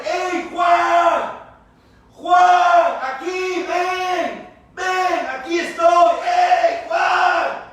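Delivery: loud, high hailing shout; calling out by name. Acted. Calling Juan to come over, loud high shout. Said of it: "llamándole por su nombre".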